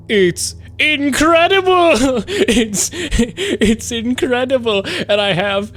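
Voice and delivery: high, slow gushing with laughter; delighted squeal. Real, not acted. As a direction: squeal over the silly gif. Showing chat funny gifs.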